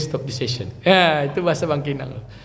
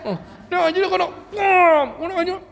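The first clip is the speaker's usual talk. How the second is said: much higher, fast, laughing scolding; laughing while angry; 11 semitones higher, faster than usual. Retold or imitated. Imitating her mother scolding while laughing, mock angry and amused. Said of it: "Sambil ketawa, sambil marah".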